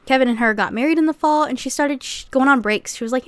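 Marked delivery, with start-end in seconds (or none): none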